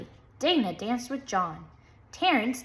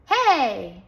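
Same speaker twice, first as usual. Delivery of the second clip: shouted hey; 2 semitones higher, 7 dB louder than usual. A narrator's reading. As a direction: punch it out like a dance cry. Dance climax shout.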